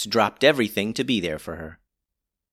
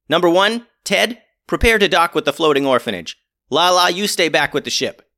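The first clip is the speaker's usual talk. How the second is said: commanding; 7 semitones higher, 6 dB louder than usual. Acted. Captain gives orders.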